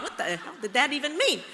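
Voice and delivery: normal talking voice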